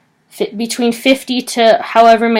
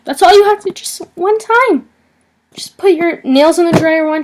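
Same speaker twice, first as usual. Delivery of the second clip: mocking disbelief; 5 semitones higher than usual. Mocking the nail tech's curt answer, incredulous and high. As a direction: echo her answer in exaggerated disbelief.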